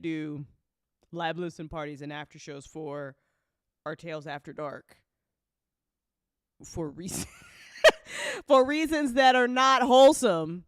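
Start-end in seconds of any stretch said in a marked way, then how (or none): none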